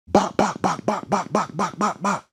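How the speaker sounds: high, sharp imitated gunfire bops; gunshot sounds. Imitating shots hitting the tour bus, sharp high pops. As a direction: spit out each bop like a shot going off.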